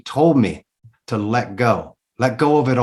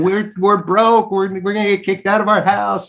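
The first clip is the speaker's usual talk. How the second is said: higher, mock tearful wife voice; imitating crying wife; 7 semitones higher than usual. Wife panicking that they will lose the house, higher mock tearful plea. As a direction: mimic her panicked pleading, pitched up. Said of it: "she's crying".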